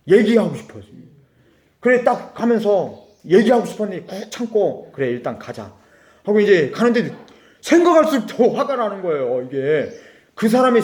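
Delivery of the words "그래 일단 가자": softer and lower, talking himself down; quiet self-talk. Real, not acted. Driver swallowing his anger, quiet self-talk. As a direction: murmur it to yourself to hold back.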